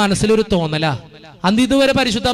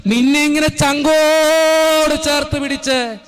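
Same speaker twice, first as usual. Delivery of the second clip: impassioned slow cry; 7 semitones higher, much slower than usual. Real, not acted. Climax of sermon.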